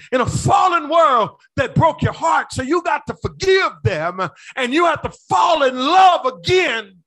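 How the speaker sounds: high, loud preaching shout; full-force preaching. Acted. Pastor rousing his congregation, full-force loud preaching.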